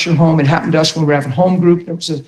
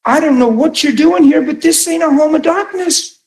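high, rising indignant challenge; high bold defiance; defiant; 8 semitones higher than usual. Retold or imitated. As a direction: stand up to the intruder. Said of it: "I didn't think".

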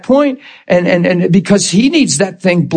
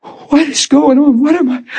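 shocked self-questioning; 9 semitones higher than usual. Retold or imitated. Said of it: "And then I went".

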